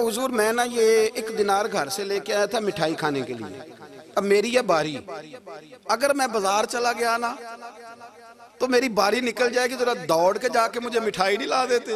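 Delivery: a hint of a pleading child; child's voice. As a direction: ask eagerly like a small boy. Child asks for sweets.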